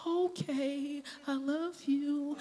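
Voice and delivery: put-on slightly high, drawn-out little girl voice; lightly voiced child. Daughter accepts mom needing space, lightly voiced child. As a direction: give in like a small child, lightly imitated. Child gives in to mother's request.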